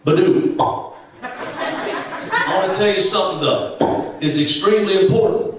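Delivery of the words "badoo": cartoon glug sound; glugging bottle noise. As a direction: mouth pop of oil glugging from a bottle. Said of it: "make a noise like this".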